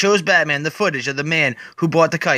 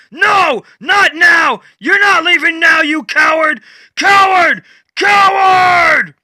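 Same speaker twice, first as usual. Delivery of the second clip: high, much louder desperate shouting; yelling; 11 semitones higher, 6 dB louder than usual. Acted. Batman screaming at Swamp Thing as he withers away. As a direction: scream after him as he leaves.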